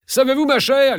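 very high-pitched, lively call. A narrator's reading. Count hailing his wife as she returns, calling out. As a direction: hail her eagerly, pitch right up. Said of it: "lui cria le comte".

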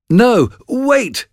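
high, urgent cry to stop. A narrator's reading. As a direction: call them back urgently, pitch way up. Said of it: "cried Macbeth".

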